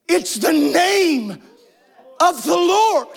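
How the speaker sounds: slow start rising to a high shout on Lord; building to a shout. Real, not acted. Preacher proclaiming the name of the Lord, rising to a shout. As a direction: build from measured to a full cry. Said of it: "so emphatic about calling the name".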